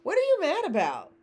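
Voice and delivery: high, playful mock confusion; high teasing why-so-angry voice; mock bewildered. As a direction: ham up being baffled and hurt. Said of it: "and go".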